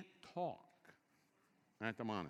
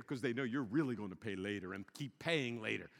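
ordinary delivery

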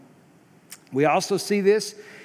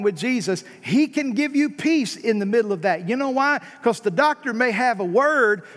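full-force preaching; 5 semitones higher than usual. Acted. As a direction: rise up and preach it to the room.

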